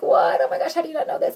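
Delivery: high, loud shocked gasp of disbelief; aghast that anyone wouldn't know; shocked friend. Retold or imitated. Friend reacting in high, loud disbelief. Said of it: "She's like".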